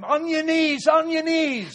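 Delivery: high, loud shouted command; urgent shout. Acted. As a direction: shout it as an urgent order to someone. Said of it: "he shouted to him".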